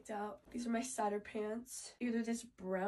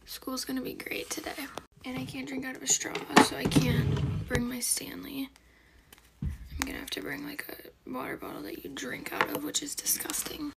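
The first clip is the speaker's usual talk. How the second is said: whispering; 3 semitones higher, 11 dB louder than usual. Real, not acted. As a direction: keep it all in a whisper. Whispers the whole video.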